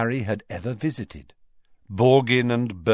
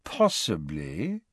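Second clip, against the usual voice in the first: slow, softer, hedging; reluctant, noncommittal; 4 semitones lower, 4 dB quieter, slower than usual. A narrator's reading. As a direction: drag it out, unwilling to commit. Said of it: "in a tone that suggested he was unwilling".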